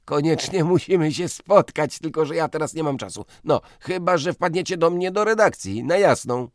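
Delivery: loud, high, heated rant; blustering editor. Acted. Piotrowicz fumes about the cheat to his guest, loud and heated. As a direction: grumble loudly about the scoundrel.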